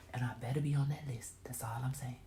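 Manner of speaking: fast whispered aside; whispering. Real, not acted. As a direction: whisper the joke quickly.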